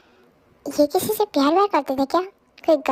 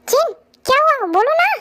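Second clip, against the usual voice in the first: urgent worry; 9 semitones higher than usual. Acted. Friend urgently asks what happened.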